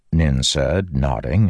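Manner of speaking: usual speaking voice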